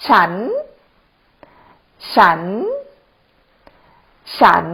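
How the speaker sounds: ฉัน said alone with a rising tone. Shown as a demonstration. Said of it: "is like a rising tone".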